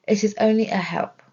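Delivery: normal talking voice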